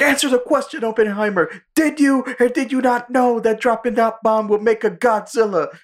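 high, pressing courtroom grilling; mock interrogator. Meme lawyer grills Oppenheimer in court, high mock demand. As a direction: hammer the question like a prosecutor.

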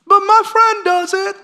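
put-on high pleading child voice; whiny seven-year-old. Pastor imitating a seven-year-old begging his parents, high whine. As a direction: whine it like a kid who wants what friends have. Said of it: "what a seven-year-old always asks us".

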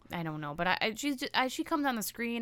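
normal talking voice

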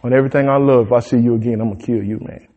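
lightly voiced Pharaoh as a low, quiet gangster; gangster talk. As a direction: mutter the threat like a gangster. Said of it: "this is gangster talk".

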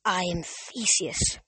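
slow, dry, papery ghost voice; slow and hushed, like dry paper. A narrator's reading. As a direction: let the words crumple like old paper. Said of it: "in a voice like dry, crumpling paper".

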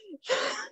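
a burst of high laughter. Real, not acted. Laughing at the mirror story to the host, high laugh.

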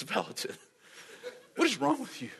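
high, disbelieving landlord cry; incredulous landlord. Retold or imitated. Landlord reacts to no money.

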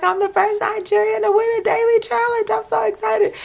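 very high squealing voice; imitating Esther's excitement. Retelling Esther's win announcement, high excited squeal. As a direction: squeal like you can't contain the joy. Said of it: "She's like".

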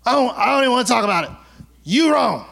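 fast, high, petulant brush-off; hammed-up sulky accuser; sulky refusal. Acted quarrel, the one who refuses.

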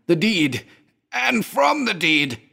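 high, slow, frantic scream; rising hysteria breaking loose. A narrator's reading. Raving man screams his warning to his friend, high and frantic. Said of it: "risen to a scream".

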